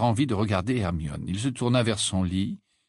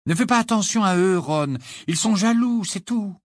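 higher voiced, soothing friend; girl reassuring her friend, voiced higher; 9 semitones higher than usual. A narrator's reading. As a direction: comfort him. Hermione reassuring.

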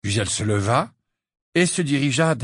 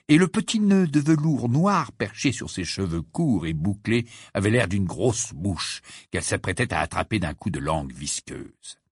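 plain reading voice

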